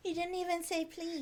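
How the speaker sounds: put-on childish whine; mock whining kid. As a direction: quietly echo a tattling child. Co-host joins in the kid's complaint.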